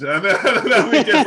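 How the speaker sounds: high, loud talk through laughter; laughing. Real, not acted. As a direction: carry on while cracking up. Laughing after own re-enacted shout.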